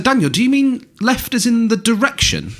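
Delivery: high, disbelieving; baffled dad asking his son; incredulous question. Retold or imitated. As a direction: ask it like you can't believe it. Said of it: "I said".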